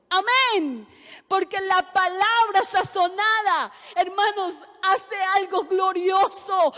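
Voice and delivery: predicando más fuerte, a pleno pulmón; predicación con fuerza. Acted. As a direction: exhorta a la congregación con fuerza. (predicadora exhortando a sus hermanos, con voz fuerte)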